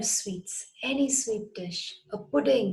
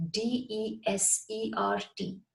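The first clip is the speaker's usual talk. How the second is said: ordinary talk, nothing marked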